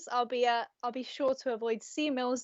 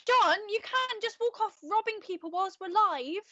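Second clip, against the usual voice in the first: exasperated; 8 semitones higher than usual. Acted. Anchor scolds co-host on air.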